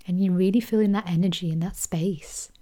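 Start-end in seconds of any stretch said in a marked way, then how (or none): 1.5-2.5 whispered. Acted. Soft moment in meditation.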